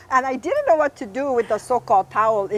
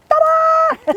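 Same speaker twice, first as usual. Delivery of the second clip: loud, high-pitched entrance cry; =high fanfare shout; showy ta-da; 8 dB louder than usual. Acted. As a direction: make a big showy entrance. Acts out walking into class.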